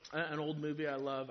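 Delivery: ordinary delivery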